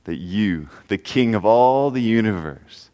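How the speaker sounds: soft, low prayer voice, slowing near the end; quiet prayer. Acted. Pastor prays softly before the congregation, quiet prayer voice. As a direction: pray quietly and earnestly to God.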